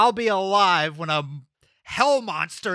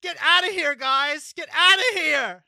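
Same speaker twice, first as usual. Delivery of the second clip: shouting; 9 semitones higher than usual. Acted. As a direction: yell them out of the room. Shouting players away.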